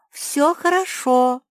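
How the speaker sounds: high, loud, slow reply; calling out. A narrator's reading. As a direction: call back slowly and clearly. Calling to someone behind the stone. (громкий протяжный ответ)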